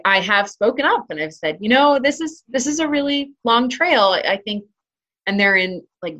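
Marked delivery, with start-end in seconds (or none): 1.6-4.6 higher-pitched, tentative word of caution; delicate suggestion. Retold or imitated. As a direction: re-enact gently warning a stranger, pitch lifted. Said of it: "trying to delicately suggest".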